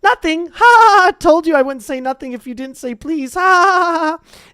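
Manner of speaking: high, loud gloating cackle; cackling poltergeist. Acted. Peeves mocking Filch with high cackles. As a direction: crow over fooling Filch.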